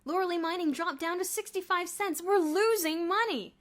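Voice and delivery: high, loud panicky outburst; frantic exclaim. A narrator's reading. Bruno tells George they are losing money, frantic. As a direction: blurt it out in a panic. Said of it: "exclaimed Bruno".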